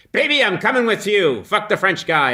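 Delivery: mock movie-hero declaration; hint of Humphrey Bogart. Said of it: "Humphrey Bogart saying to Ingrid Bergman".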